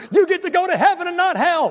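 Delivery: high, excited preacher's cry; exclaimed exhortation. Real, not acted. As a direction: rouse an unmoved crowd, pitch way up. Chides congregation for lacking excitement.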